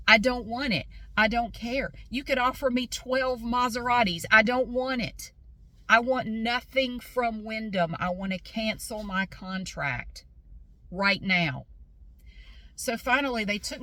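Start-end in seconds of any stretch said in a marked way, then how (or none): 0.1-5.3 raised, emphatic rejection; adamant refusal. Retold or imitated. Customer refusing salesman's extra offers, adamant. 5.9-11.7 slow, word-by-word insistence; emphatic demand. Retold or imitated. Customer demanding Wyndham cancel her contract, emphatic and slow.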